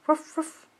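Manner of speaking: put-on playful woof woof for a toddler; dog woof. Shown as a demonstration. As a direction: bark it like a cheerful puppy. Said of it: "Puppy say".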